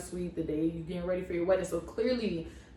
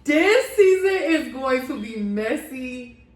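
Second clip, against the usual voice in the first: louder, laughing exclaim; laughing disbelief; 3 semitones higher, 6 dB louder than usual. Retold or imitated. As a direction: react to the drama in giddy shock. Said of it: "I was just like".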